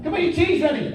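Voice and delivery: high, loud parent calling across the street; calling kids in. Retold or imitated. Mum calling the kids in for tea, high and loud.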